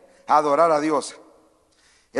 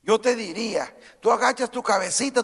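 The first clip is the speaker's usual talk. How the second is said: ordinary talk, nothing marked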